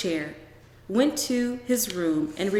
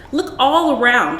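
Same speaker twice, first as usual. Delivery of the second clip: loud, higher call from a distance; calling orders; 5 semitones higher, 8 dB louder than usual. Acted. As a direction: shout the order down the hall. Mother calling orders from hallway.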